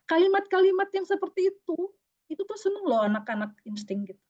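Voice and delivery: normal talking voice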